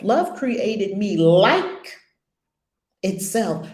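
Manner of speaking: "like" held long and loud, pause after; slow down and belt out "like"; stress on LIKE. Shown as a demonstration. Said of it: "Emphasis on each word".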